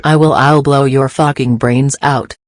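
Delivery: loud, raging threat; furious yelled threat; furious shout. Acted. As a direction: scream the threat at him. Threatening to shoot him.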